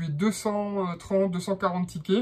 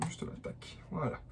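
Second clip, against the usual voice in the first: whisper; 2 semitones lower, 14 dB quieter than usual. Real, not acted. Clicking on screen, muttering aside.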